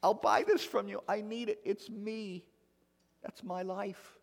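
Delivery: high, tearful begging lady; pleading in tears. Retold or imitated. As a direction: beg the pastor, voice breaking high. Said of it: "she started crying".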